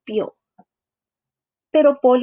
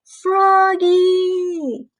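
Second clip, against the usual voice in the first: high call across the yard; 8 semitones higher, 3 dB louder than usual. Acted. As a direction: call him from a distance. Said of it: "lo llamó su mamá". (llamada aguda, desde lejos)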